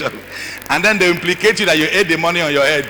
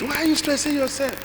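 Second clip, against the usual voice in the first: high, mildly whining question; whiny daughter; 9 semitones higher, 7 dB quieter than usual. Retold or imitated. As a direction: tease mom in a high voice. Daughter scolds mother, voiced.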